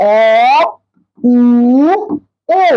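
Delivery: vowels with lips made round. Shown as a demonstration. Teacher demonstrating rounded vowels. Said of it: "ঠোঁটগুলা গোল থাকবে".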